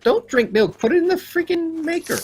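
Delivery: squeaky panicked instructions; urgently yelling up high; frantic high yelling. Real, not acted. Urgent shouting at partner.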